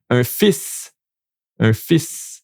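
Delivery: fils said as "fiss", no l; un fils, L silent. Shown as a demonstration. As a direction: say un fils slowly with no l. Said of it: "We don't pronounce the L".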